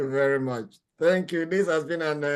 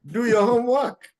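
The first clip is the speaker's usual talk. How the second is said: raised, louder mock scolding; mock admonishing; 6 semitones higher, 5 dB louder than usual. Host jokingly warns students to heed the lesson, mock admonishing. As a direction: playfully drive the lesson home.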